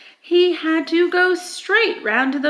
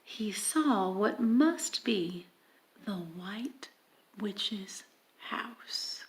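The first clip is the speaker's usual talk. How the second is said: hushed whisper; 5 semitones lower, 10 dB quieter, slower than usual. A narrator's reading. Reader whispering the Witch's house to the listeners. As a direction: breathe the name in awe.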